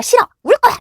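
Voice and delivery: put-on high, loud whiny kid's refusal; defiant child. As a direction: shriek it like a stubborn toddler.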